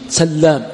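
سلام with the L doubled, sallām; سلام as sal-LAAM; doubled lam. Shown as a demonstration. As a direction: press and hold the lam in سلام. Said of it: "والباقون بالتشديد".

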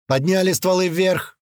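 high, slow, forceful order; commanding shout. A narrator's reading. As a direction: order the captives about. Gunman orders captives.

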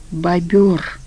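ordinary delivery, nothing marked

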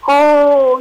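loud, high happy cry of discovery; joyful shout. A narrator's reading. Rabbit finally remembers the magic words, joyful loud cry. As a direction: burst out with glee, high and loud. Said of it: "وبعد قليل صاح فرحا".

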